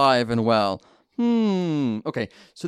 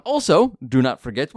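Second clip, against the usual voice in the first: ordinary delivery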